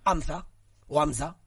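"Hamza" said as Anza, no h at all; silent H Hamza. Shown as a demonstration. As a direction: start "Hamza" straight on the vowel. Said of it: "¿no sabes decirlo?".

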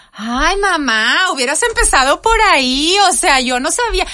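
mock a kid's exasperated relief; hammed-up childish "ay, mamá" whine; child's relieved outburst. Son's relieved outburst retold.

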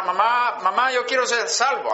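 small child asking mom to be saved, lightly voiced; child pleading. Boy at five begging his mother, mild pleading child voice. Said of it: "Así dije".

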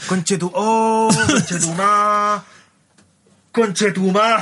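drawn-out anguished swearing; mock anguish. Bystander watching a fire get worse, mock anguish. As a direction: stretch the curse out in mounting distress. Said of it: "su conchetumadre se pone más angustioso".

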